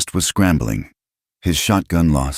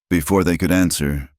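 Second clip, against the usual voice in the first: plain reading voice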